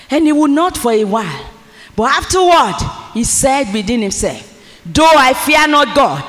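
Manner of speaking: loud, rising preacher's reading of the parable; preaching scripture. Acted. Preacher reads the parable to the congregation at full force.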